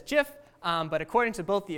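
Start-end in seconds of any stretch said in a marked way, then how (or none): 0.0-0.3 "gif" with a soft g, like j; soft-g pronunciation. Shown as a demonstration. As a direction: say "gif" as "jiff". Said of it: "side of the Holy War, War that says gif".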